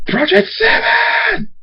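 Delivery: very high, drawn-out scream. Acted. Thunderstorm sees his enemy, furious high scream. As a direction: scream the name, stretching it out. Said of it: "Thunderstorm screams".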